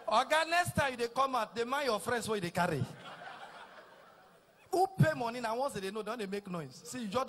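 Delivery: normal talking voice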